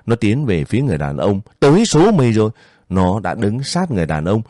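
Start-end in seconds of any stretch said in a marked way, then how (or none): 1.6-2.5 raised, higher-pitched threat; menacing threat. A narrator's reading. Armed guard closing in on a man, menacing threat. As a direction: push the threat up high, understated anger.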